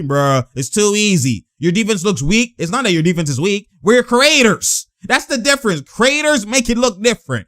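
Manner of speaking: ordinary talk, nothing marked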